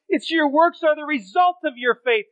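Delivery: ordinary talk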